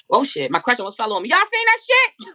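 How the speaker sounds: mock surprise from the friend; imitating weed man. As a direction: rise into a high stretched question. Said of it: "He said".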